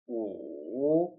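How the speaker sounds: U dipping low then rising; third tone u. Shown as a demonstration. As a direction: dip U down then bring it up. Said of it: "Repeat after me".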